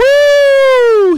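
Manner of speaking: high, loud whoop; excited whoop. Real, not acted. Host cheering the guest's rant with a loud whoop. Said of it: "He is flaming".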